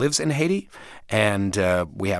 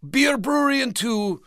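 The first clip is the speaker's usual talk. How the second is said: put-on thick Dutch accent; 13 semitones higher than usual. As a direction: play the crazy Dutch landlord with his Dutch accent. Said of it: "he had this thick Dutch accent".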